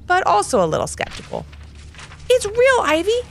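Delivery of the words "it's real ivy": excited. Acted. Boy reveals treasure to sister.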